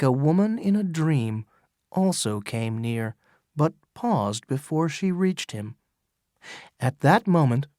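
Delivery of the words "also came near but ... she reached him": plain reading voice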